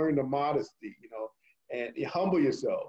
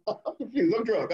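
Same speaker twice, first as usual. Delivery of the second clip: laughing; 6 semitones higher than usual. Real, not acted. Joking about being confused.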